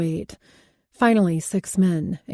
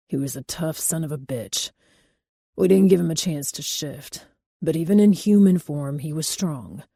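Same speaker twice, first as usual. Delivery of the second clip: grim warrior voice. Acted.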